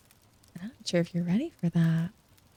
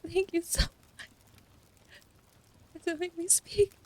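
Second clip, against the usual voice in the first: giggly thanks; 9 semitones higher, 4 dB quieter than usual. Real, not acted. Still giggling thanking chatters.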